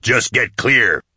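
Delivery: strained, drawn-out roar; slow roar under strain. A narrator's reading. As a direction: roar it back, dragging out each word. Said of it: "Nail roared back".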